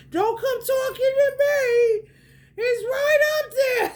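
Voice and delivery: high, squealing through laughter; loud high-pitched cry, cracking up; laughing mock protest. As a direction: hold back laughter while protesting. Laughing hard while acting out warning.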